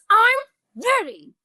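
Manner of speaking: put-on high, drawn-out angry kid yell; angry child. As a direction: act out a mad son forcing the words out. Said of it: "your son is angry".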